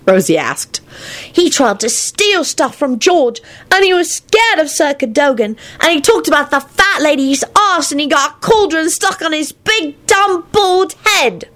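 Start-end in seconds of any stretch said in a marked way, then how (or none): 1.3-11.5 put-on high, indignant little boy; tattling child. A narrator's reading. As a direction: reel off complaints in a high child's voice. Child lists embarrassing deeds.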